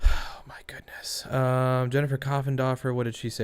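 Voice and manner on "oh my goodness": whispered sigh. Real, not acted. Exasperated reaction to the case.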